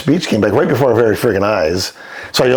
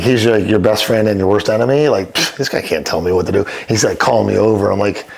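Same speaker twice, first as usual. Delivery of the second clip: normal talking voice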